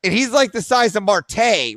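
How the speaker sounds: normal talking voice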